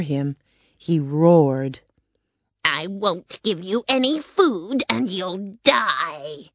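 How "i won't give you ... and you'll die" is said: wicked witch, drawn out at the end; witch's threat. A narrator's reading. Witch threatening the tied-up lion, menacing. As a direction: threaten in a put-on witch voice, dragging the last word. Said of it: "the witch cried".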